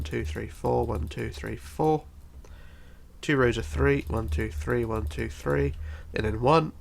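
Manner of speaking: normal talking voice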